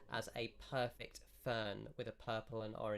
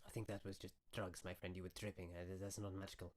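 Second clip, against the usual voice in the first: lightly voiced wizard, softly debunking; dismissive wizard; 2 semitones lower, 7 dB quieter, faster than usual. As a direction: gently let the friend down, softer voice. Game master voices the wizard.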